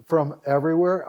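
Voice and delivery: ordinary talk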